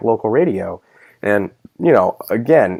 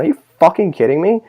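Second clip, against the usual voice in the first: incredulous; 8 semitones higher than usual. Retold or imitated. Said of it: "I'm sitting there going".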